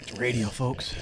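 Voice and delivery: lightly put-on radio jock voice. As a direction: ease into the DJ voice. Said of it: "Should I go jock voice?".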